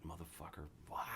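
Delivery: mock yell kept under the breath; retold flip-out. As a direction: act out blowing up in a hushed, whispered voice. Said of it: "I fucking flip out".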